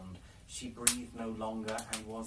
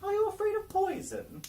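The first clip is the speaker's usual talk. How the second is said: much higher and louder, coaxing crone; mock the girl's fear to tempt her; sly old woman; 6 dB louder than usual.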